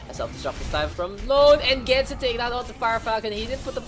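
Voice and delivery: loud, high hype call; excited play-by-play. Real, not acted. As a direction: call the big play loudly.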